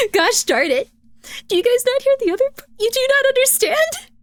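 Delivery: high-pitched, laughing disbelief at chat; exasperated laughing outburst. Real, not acted. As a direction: throw your hands up, giggling.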